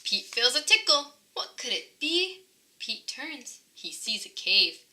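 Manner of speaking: ordinary delivery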